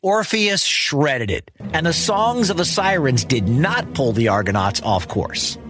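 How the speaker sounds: ordinary delivery, nothing marked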